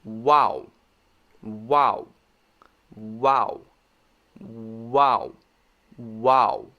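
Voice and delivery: "Wow" with rounded lips at both ends. Shown as a demonstration. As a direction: catch and release "Wow" with the lips. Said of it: "The next one".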